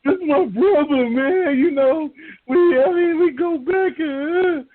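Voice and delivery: high, cracking fake sob; hammed-up crying, slowing down; mock tearful eulogy. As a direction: play a grieving teammate, overdone. Mock grieving speech.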